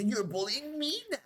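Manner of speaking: high, whiny mock victim voice; mocking bullies. Vlogger mocking bullies who play the victim, high whiny voice. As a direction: whimper it like a fake victim. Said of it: "they turn around and say".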